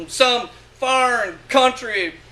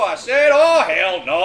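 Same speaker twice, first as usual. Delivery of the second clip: plain reading voice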